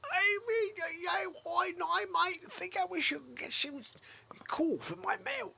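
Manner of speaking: lightly mock child voice; little boy voice. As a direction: play a small boy pleading. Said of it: "He's like".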